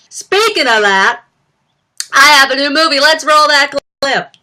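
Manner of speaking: high, loud talk-show host parody. Acted. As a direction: throw to a clip as a hammed-up star. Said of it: "impression of [name] on S N L doing [name]".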